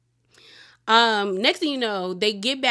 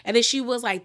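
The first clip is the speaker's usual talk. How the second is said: usual speaking voice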